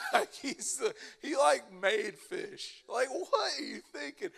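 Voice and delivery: high laughing disbelief; giggling at his own joke. Real, not acted. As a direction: laugh through the punchline.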